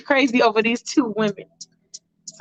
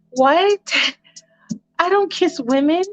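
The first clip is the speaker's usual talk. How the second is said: shocked; 7 semitones higher than usual. Retold or imitated. Allison shocked at the dare.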